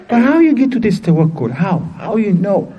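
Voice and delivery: high-pitched, insistent asking; retold eager questioning. People ask Hatim about his tawakkul, eagerly puzzled. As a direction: press him for the secret.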